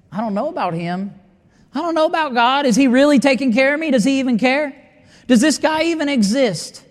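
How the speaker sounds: acted-out high-pitched doubt; doubting inner voice. Voicing a doubter's inner questioning.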